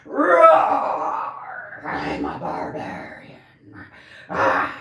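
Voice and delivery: loud roar, then a hushed whisper; playful barbarian roar; mock barbarian. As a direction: ham up a barbarian growl then drop to a whisper. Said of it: "barbaric about it. Like".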